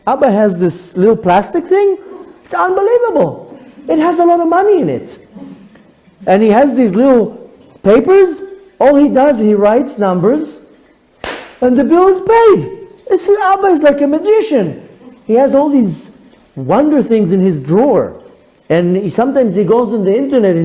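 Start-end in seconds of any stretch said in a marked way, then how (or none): 0.1-5.2 put-on high, wide-eyed little girl; child voice. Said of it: "She says". 6.3-18.2 put-on child's wonder. Daughter's view of father, marvelling.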